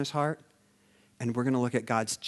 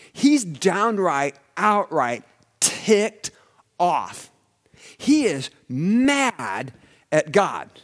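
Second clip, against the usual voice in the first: slow, punched and louder; drawn-out preacher emphasis; emphatic build; 5 semitones higher, 7 dB louder, much slower than usual. Acted. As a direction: hammer each word of the anger home. Preacher building on Jonah's anger.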